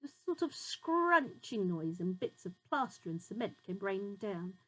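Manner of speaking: ordinary delivery, nothing marked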